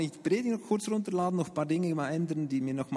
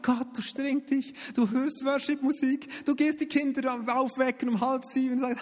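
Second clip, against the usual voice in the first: much higher, sing-song happy; mock bliss; 10 semitones higher than usual. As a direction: paint the perfect morning, overly sunny. Mock-blissful everyday life.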